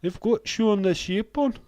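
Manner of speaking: loud, high-pitched mock Welsh accent; hammed-up Welshman calling out, accent slipping towards Geordie; bad Welsh accent. Streamer imitating shocked Welsh viewers at tea time, bad Welsh accent. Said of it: "I can't do a Welsh accent".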